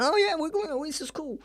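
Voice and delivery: put-on high, dopey little kid voice; silly little brother. Younger brother loving the bad film, dopey high voice. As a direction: play the clueless happy little brother. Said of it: "They're like".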